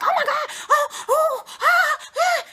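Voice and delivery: very high, loud fangirl shrieks; starstruck squeal. Retold or imitated. Cousin meeting famous people, high loud starstruck squeals. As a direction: squeal like a fan losing it. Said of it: "she will cut up like".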